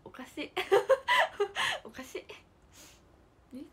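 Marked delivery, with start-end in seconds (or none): 0.6-1.8 a sudden high squeal of laughter; high burst of laughter. Real, not acted. Laughing at the child's oddness. (甲高く吹き出して笑う)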